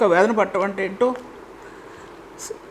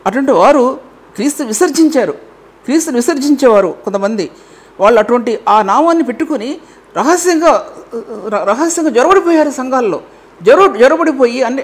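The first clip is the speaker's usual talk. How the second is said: louder and higher, a warning thundered out; forceful preaching; 4 semitones higher, 5 dB louder than usual. Acted. Preacher warning the church about secret intruders, loud and forceful. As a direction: sound the alarm from the pulpit. Preacher denounces hidden false believers.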